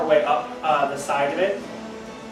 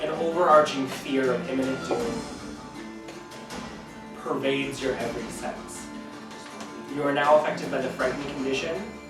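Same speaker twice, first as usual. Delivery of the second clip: story narration; 2 semitones lower, 7 dB quieter than usual. Acted. DM narrating with music.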